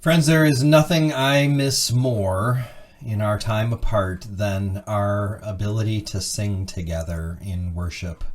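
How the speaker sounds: ordinary talk, nothing marked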